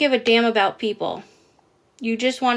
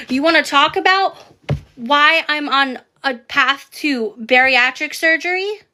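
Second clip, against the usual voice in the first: heated, raised voice at a troll; angry yelling, pitch climbing; 3 semitones higher than usual. Real, not acted. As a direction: shout down the fat-shaming commenter. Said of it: "I'm yelling".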